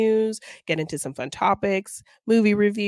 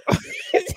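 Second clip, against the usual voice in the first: breathy laugh; 14 semitones higher than usual. Real, not acted.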